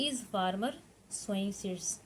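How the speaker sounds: very slow, word by word for students to write; slow, careful dictation pace. Shown as a demonstration. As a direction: spell it out slowly for the class to copy. Teacher dictating English question.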